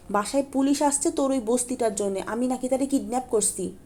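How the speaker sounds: furious rant at a friend; angry outburst. Acted. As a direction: let it all pour out in rage. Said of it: "কথার বন্যা ভাসিয়ে দিল".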